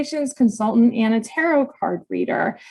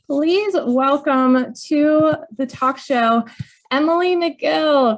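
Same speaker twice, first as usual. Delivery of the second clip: slow, drawn-out show intro; announcer welcome; 5 semitones higher, slower than usual. Real, not acted. Host introducing her guest, slow announcer style.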